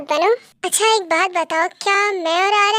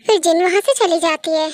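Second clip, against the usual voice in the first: ordinary delivery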